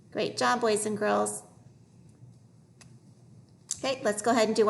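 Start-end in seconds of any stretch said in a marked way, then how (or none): none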